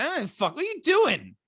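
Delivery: high-pitched exasperated yelp; retold alarmed protest. Five-year-old protesting to dad on the ride, high-pitched alarm. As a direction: jump up high in mock outrage. Retelling shocked reaction on ride.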